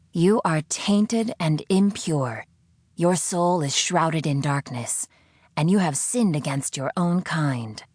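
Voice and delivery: slow, heavy condemnation; accusing zealot voice. Acted. The unseen captor condemning the prisoner, slow solemn judging voice. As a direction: pronounce her sins slowly, like a judge.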